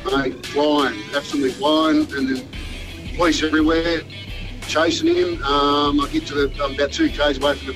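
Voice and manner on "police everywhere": ordinary talk, nothing marked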